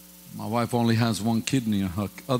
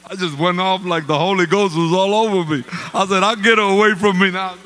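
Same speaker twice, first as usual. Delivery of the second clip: louder and higher, half laughing; fired up; 6 semitones higher, 6 dB louder than usual. Retold or imitated. After the prophetess rebukes him, fired-up outburst. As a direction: let it burst out.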